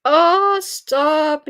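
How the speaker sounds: high loud mock-alarmed oh stop; mock plea to stop; playful protest. Real, not acted. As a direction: squeal in playful dread. Reacting to scary book news.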